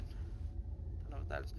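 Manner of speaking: barely voiced aside to himself; whispered aside. Real, not acted. Reader mutters an aside about a strange word, whispering. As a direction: whisper it under your breath.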